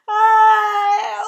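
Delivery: high, drawn-out roar of pain; roared howl. A narrator's reading. Giant thrashing in his sleep, roaring howl. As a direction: howl it long and very loud. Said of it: "roared the flesh lump eater".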